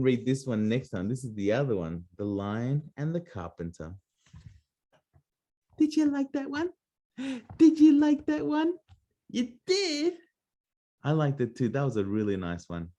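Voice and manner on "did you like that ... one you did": much higher, bright sing-song question. Real, not acted. Asking the little listener if they liked it, high sing-song. Asks the child after story.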